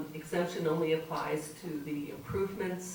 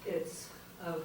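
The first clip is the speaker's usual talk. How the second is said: usual speaking voice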